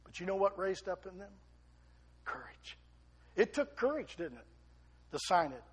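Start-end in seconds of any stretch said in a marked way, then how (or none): 2.2-2.8 breathy, hushed single word; whispered answer. Acted. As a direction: drop to a whisper for effect. Dramatic pause, answering own question.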